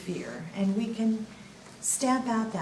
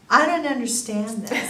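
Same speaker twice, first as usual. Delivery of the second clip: laughing quote; 3 semitones higher, 8 dB louder than usual. Retold or imitated. Quoting puzzled doctor, laughing.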